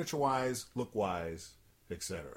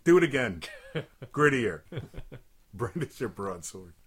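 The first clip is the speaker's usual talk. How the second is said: director voice; 4 dB louder than usual. Retold or imitated. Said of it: "Let me see Marty saying".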